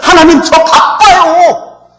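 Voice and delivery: slow, drawn-out mock plea; hurried layman's prayer. Layman praying to God, drawn out. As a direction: stretch out a childlike plea to God. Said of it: "그냥 집사하고 그냥 평신들".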